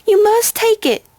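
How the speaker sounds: high, loud, pleading cry; intense cry. A narrator's reading. Filly forcing the ring on Twilight, intense cry. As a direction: press it on her with intensity. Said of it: "the filly cries with intensity".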